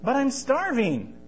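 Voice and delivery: put-on high, slow, pleading boy voice. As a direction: beg like a hungry kid.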